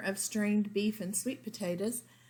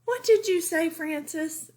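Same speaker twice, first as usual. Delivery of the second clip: father asking; 9 semitones higher, 6 dB louder than usual. A narrator's reading. Father questions Frances.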